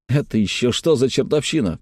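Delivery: plain reading voice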